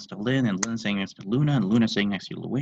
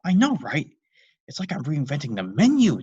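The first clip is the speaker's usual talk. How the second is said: higher, louder proud dad; excited; 6 semitones higher, 8 dB louder than usual. Acted. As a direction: gush about your new idea. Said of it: "affirmed excitedly".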